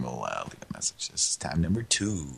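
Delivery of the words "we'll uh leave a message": whispering. Real, not acted. Whispering while call rings.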